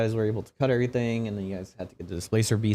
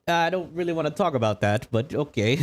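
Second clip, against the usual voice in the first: reluctant guest; 7 semitones higher than usual. Acted. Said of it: "And she's like".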